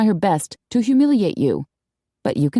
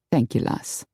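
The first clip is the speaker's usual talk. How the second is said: low, soft, gruff thanks; lightly voiced low male reply; quiet thanks, pitched down; understated Scotsman; 6 semitones lower, 5 dB quieter than usual. A narrator's reading. Connor's soft low reply in story.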